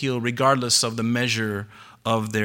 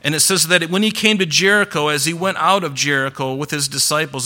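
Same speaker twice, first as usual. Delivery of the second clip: ordinary delivery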